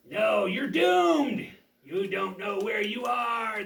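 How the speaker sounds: gloating villain shout. Acted.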